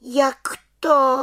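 high, loud affronted question; hurt, offended. A narrator's reading. Little witch questions head witch, sounding offended. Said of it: "dotčeně se zeptala".

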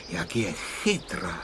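plain reading voice

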